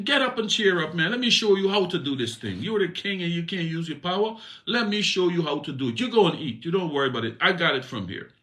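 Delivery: Jezebel's voice. Acted. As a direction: brush him aside, I've got it.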